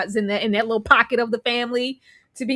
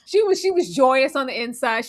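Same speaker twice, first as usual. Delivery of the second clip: ordinary talk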